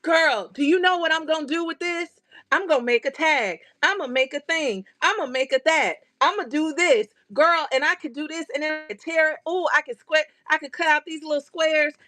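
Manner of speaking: high, rapid-fire eager ideas; excited planning. Retold or imitated. Crafter telling friends her plans for the fabric, high and eager. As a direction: spill every craft idea at once. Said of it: "And they looking at me like".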